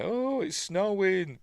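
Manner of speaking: high, slow, drawn-out wonder; mock amazement. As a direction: act amazed, stretching it out high. Retold character sees snow indoors.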